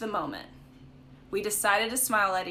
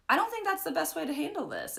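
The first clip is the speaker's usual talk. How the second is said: higher, careful advising tone to a friend; a sweet, tactful nudge, pitched up; gentle advice voice; 8 semitones higher than usual. Retold or imitated. Advising a friend about her boyfriend, higher gentle tone. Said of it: "and I was always like".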